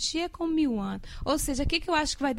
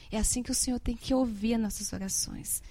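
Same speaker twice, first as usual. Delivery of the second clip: ordinary delivery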